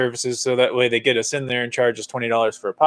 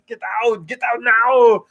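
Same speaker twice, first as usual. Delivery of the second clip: Arnold Schwarzenegger impression; 6 semitones higher, slower than usual. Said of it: "Give me a little Commando".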